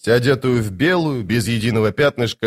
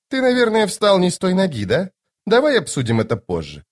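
high, lightly chiding lady; Lady Envy, chiding; 8 semitones higher than usual. Acted. Lady Envy putting off Toc's question, high and chiding. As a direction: brush him off in a high voice.

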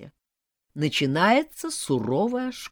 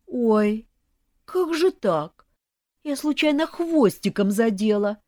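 high, louder wail of fake regret; mock sorrow; 6 semitones higher, 5 dB louder than usual. A narrator's reading. In-law who smashed a plate, feigning sadness. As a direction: play sorry and innocent, overdone. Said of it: "состроила самое грустное выражение лица".

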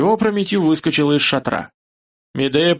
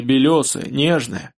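usual speaking voice

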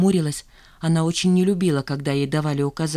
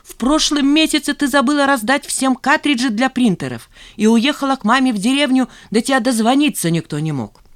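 higher, heated list of accusations; indignant tirade; 6 semitones higher, 3 dB louder than usual. A narrator's reading. Zhanna listing Zina's past blunders, indignantly. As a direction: pile up the charges with indignation. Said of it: "Жанну трясло от возмущения".

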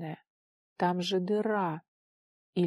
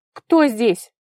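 loud, higher, agitated question; agitated, voice breaking; 4 semitones higher, 13 dB louder than usual. A narrator's reading. Vara calls to an unseen stranger, agitated. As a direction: call out with nervous agitation. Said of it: "срывающимся от волнения голосом".

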